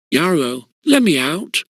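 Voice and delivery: ordinary delivery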